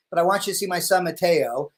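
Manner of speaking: ordinary delivery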